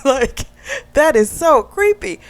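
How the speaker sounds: real laugh breaking into a squealed "so creepy"; laughing disgust. Real, not acted. Laughing reaction to creepy confession.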